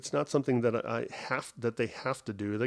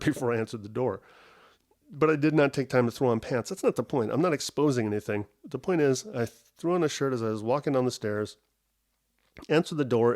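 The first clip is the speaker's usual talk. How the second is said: usual speaking voice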